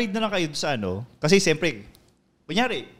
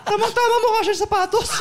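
wife voice; 14 semitones higher, 4 dB louder than usual. Pretend. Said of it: "Oh, kunyari".

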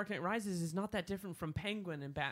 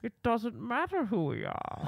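slow, booming masked villain voice; Bane voice; 7 semitones higher, 5 dB louder, slower than usual. Retold or imitated. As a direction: rumble it out like Bane. Singing Bane speech, offered to sing.

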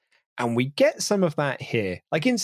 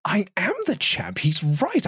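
high, put-on self-important voice; hammed-up earnest boasting, pitched high; mock self-belief; 8 semitones higher than usual. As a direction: imitate a champ who takes flattery seriously. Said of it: "appear to think he's being serious".

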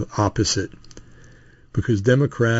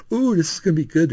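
higher-pitched, mock-excited aside; gleeful anticipation; 8 semitones higher than usual. Onlooker expecting a fight in the pharmacy, gleeful. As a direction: relish the brewing trouble, lightly acted. Relishing a coming fight, retold.

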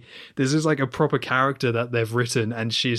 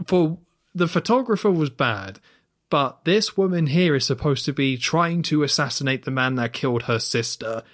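ordinary talk

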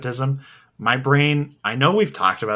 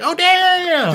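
excited exclamation; 8 dB louder than usual. Retold or imitated. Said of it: "I was like".

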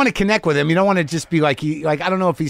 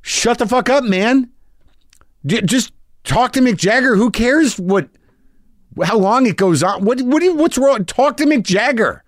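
raised, higher-pitched exasperated friend; Brendan yelling; 9 semitones higher than usual. Retold or imitated. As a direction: push the words up high, fed up with him. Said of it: "Brendan's like".

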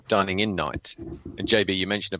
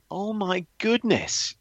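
slow, much higher "oh my goodness"; amazed; 11 semitones higher, slower than usual. Retold or imitated. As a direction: draw it out in wonder, voice lifted high. Amazed reaction to the story.

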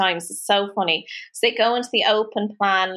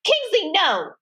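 high sharp call to the dog, stopping him at the door; 9 semitones higher, slower than usual. Retold or imitated. Kim calling her dog back at the door, high and urgent. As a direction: call the dog's name up high, firm.

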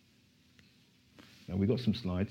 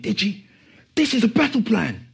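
firm, higher, louder insistence; determined pastor; 11 semitones higher, 5 dB louder than usual. Retold or imitated. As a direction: press it as a battle plan. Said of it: "and he was determined".

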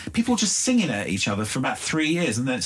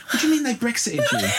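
incredulous mock shout; 11 semitones higher than usual. To her son about the new slang, high and laughing in disbelief. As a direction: act the baffled parent, half laughing.

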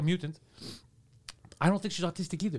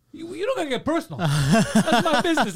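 mock defensive protest; 8 semitones higher, 8 dB louder than usual. Retelling a dating show guy's embarrassed protest, high and laughing. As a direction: play him brushing off a nosy question, half laughing. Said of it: "He's like".

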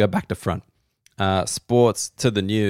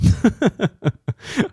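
high laugh; 11 semitones higher than usual. Real, not acted. Laughs after hating Daily Mail.

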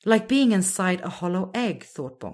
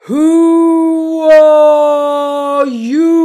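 huge, slow, much higher and far louder call; booming voice; 10 semitones higher, 16 dB louder, much slower than usual. A narrator's reading. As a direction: boom it from everywhere. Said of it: "the voice boomed out".

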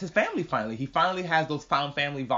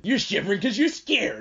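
higher put-on taunting voice; taunting character; 5 semitones higher than usual. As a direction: mimic a mocking taunt.

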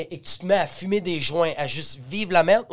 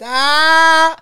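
cri aigu imité; 10 semitones higher, 9 dB louder than usual. Retold or imitated. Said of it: "j'ai fait".

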